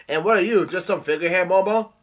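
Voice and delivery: loud, high challenge rising at the end; yelling out. A narrator's reading. Luffy challenges young Momo, yelling out. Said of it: "Luffy yells out".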